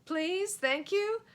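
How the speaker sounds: high, loud hopeful blurting; nervous try. Acted. Joe nervously blurts polite words as magic. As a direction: offer the polite words in a panic.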